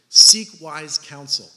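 Seek wise counsel, slow and loud with weight on wise; slow booming emphasis on wise; stress on wise. Shown as a demonstration. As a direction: hammer the word wise. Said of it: "Emphasis on the wise counsel".

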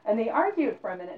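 usual speaking voice

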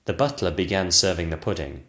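usual speaking voice

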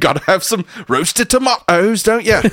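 high hammed-up British tag question; mock British accent. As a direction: play a cheery Brit at breakfast. Continuing British breakfast bit.

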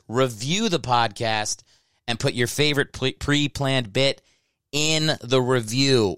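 spelled out slowly for listeners; slow, deliberate plug. Real, not acted. Host to listeners, asking for reviews: slow and deliberate. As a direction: lay each word down slow so they remember.

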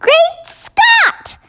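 very high, startled exclamation; high-pitched queenly shock; shocked cry. A narrator's reading. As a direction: cry out in astonishment. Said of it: "cried the famous voice".

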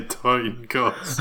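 "toin cost" drawn out with swapped first sounds; mocking echoed slip. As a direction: repeat "toin cost" slowly, T first and K second. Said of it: "Did you mean to say toin cost".

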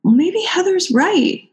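high, slow, soothing mock executive; placating executives. Executives placating in a high mock voice. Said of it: "they all started jumping in and going".

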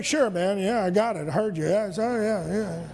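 low, mumbled, fading; bored kid. Retold or imitated. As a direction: brush it off without listening. Child brushing off a parent.

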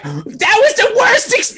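very high, slow wail; hammed-up, high-pitched distress; jittery mom impression. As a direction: imitate a shell-shocked mother. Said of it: "she's jittery. She's like".

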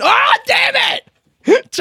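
sudden high frustrated yell; a real burst of annoyed shouting. Real, not acted. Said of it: "Sorry, that was yelling".

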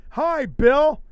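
high, loud singsong hello; loud, high cheery mock greeting; Fiend's cheery greeting. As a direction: chirp it like a creepy kids' host. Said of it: "We like".

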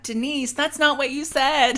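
high-pitched, drawn-out accusation at Denise; mock confrontation. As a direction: call her out in an exaggerated high voice. Acting out a castmate confronting Denise.

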